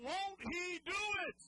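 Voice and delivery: very high, loud preacher shout; loud shout of faith; shouted with conviction. Shown as a demonstration. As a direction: belt it to the back row. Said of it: "say it again".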